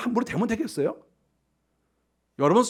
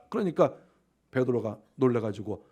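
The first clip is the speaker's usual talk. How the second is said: usual speaking voice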